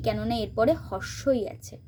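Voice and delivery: ordinary delivery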